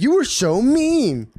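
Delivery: high, slow mocking whine; high drawn-out mock complaint. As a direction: drag it out like a sulky child. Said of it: "she said".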